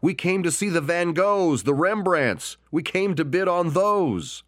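high, indignant interruption; interrupting bidder. Acted. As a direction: cut the auctioneer off in protest. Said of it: "Another voice interrupted him".

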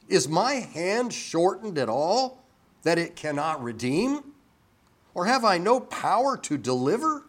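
God's pointed questions, pitched high and forceful; rhetorical challenge. Acted. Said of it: "It's rhetorical".

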